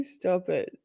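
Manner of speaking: ordinary talk, nothing marked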